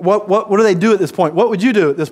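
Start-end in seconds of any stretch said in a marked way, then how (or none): none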